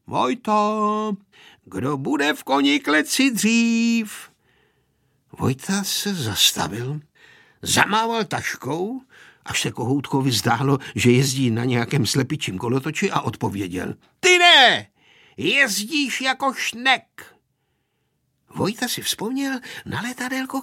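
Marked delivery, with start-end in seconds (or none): 0.1-4.3 high, drawn-out call. A narrator's reading. Pepíček calls ahead to Vojta through cupped hands, calling out. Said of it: "Udělal si z dlaní kornoutek kolem úst a vykřikl". 14.2-17.3 high loud burst, then slow drawn-out jeer; shouted taunt. A narrator's reading. Vojta answers Pepíček's challenge from ahead, shouted taunt.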